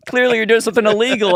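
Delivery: high, loud, fast mock suspicion; mom accusing. Mom accusing her son of crime, high fast mock suspicion. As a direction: tease him like a suspicious mother. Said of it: "She's like".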